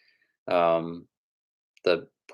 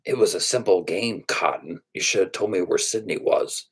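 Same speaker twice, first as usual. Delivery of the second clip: lightly voiced menacing killer taunt; a hint of a movie villain. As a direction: deliver a villain's line, drawing out the final threat.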